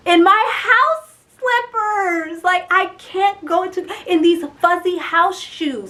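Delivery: high, loud, drawn-out wail of dismay; distressed whining. Retold or imitated. Woman facing court in slippers, loud dismayed whine. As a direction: wail it in comic despair.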